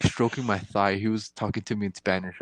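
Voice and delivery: ordinary talk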